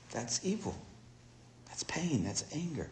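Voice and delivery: very soft, hushed objection; fearful refusal. Retold or imitated. Tribe refuses missionary at the river, hushed refusal. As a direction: shrink back into a near-whisper.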